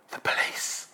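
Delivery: put-on small, breathy child whispering; child's whisper. Small boy whispers to salesman on phone. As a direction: whisper it like a scared little boy.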